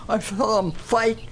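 usual speaking voice

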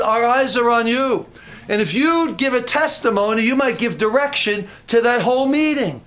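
high, driving preacher's delivery; impassioned preaching. Real, not acted. Preacher telling congregation God might use them, impassioned.

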